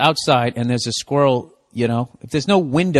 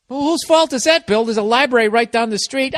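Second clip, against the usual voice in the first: self-heckling voice; 7 semitones higher than usual. Acted. Heckles himself as another voice.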